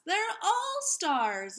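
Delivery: high, slow joyful realisation; exclaiming. A narrator's reading. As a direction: announce it in wonder. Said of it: "exclaimed Keisha".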